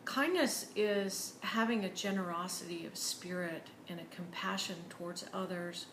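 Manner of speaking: ordinary talk, nothing marked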